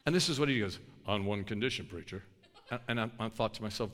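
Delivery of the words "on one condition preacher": big guy's voice. Retold or imitated. Big guy answers the preacher.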